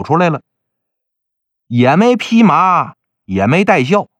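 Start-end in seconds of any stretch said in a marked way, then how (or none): none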